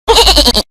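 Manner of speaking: loud, high bleating goat imitation. Shown as a demonstration. Teacher imitates a goat for fun, loud bleat. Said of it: "imagine the sound of a goat".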